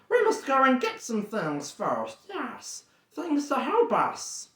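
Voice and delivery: high, hissing creature voice; wheedling Gollum, pitched high. Acted. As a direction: croon it as a slimy cave creature talking to itself.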